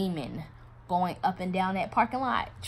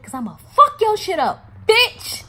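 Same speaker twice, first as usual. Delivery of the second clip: high, loud yell, peaking on the last word; furious shouted threat; 13 semitones higher, 11 dB louder, slower than usual. Retold or imitated. Betrayed woman walking out, shouting furiously at him.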